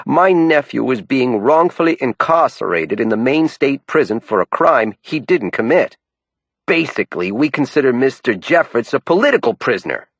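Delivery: raspy New York man pushing; New York accent. A narrator's reading. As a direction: press him, New York style. Bell's dialogue.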